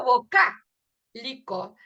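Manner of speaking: vocálico with the cá drawn out, slow and loud; stress on the cá. Shown as a demonstration. Teacher showing students the stressed syllable, slow and loud. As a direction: lean hard on the cá of vocálico. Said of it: "la A es la que se pronuncia con más fuerza".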